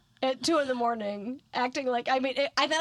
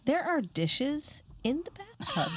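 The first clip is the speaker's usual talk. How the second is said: lightly voiced child, slow and higher in disbelief; baffled daughter; 6 semitones higher, slower than usual. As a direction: imitate a puzzled kid, spacing the words out. Daughter's baffled discovery, retold.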